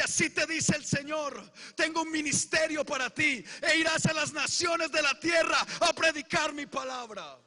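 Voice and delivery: loud, fervent prophecy in the preacher's voice; prophetic shout. Retold or imitated. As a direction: proclaim it like a preacher prophesying over him. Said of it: "el pastor me dice". (profecía proclamada a gritos, con fervor)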